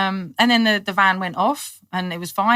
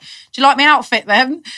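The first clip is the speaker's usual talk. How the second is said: higher, louder teasing question; cheeky; 7 semitones higher, 8 dB louder than usual. Retold or imitated. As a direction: tease the sergeant about your costume. Cheeky to desk sergeant.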